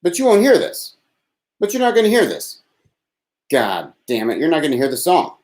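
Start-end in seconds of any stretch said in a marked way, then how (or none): 0.0-2.6 high teasing. Pretend. Teasing listeners who can't hear.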